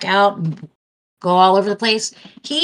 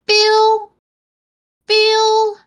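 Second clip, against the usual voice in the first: very high, loud kitten cry; talking cat; 7 dB louder than usual. Retold or imitated. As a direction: meow it like a cat calling a name. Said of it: "that cat was saying his name".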